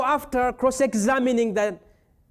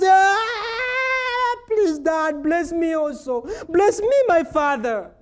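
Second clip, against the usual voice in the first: mock wailing plea; 8 semitones higher, 5 dB louder, slower than usual. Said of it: "he cried with exceedingly great, bitter cries".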